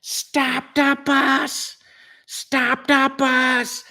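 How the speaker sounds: high, slow drawn-out shouted call; mock yell. Greg in the back yelling to the bus driver, high drawn-out call. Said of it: "and he goes".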